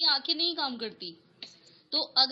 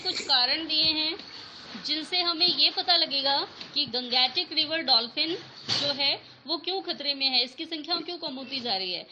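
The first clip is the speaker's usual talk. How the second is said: ordinary talk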